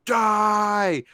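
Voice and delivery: sudden high battle yell; short high-pitched war cry; imitated scream. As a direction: scream it like a soldier firing wildly. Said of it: "he keeps screaming as you shoot".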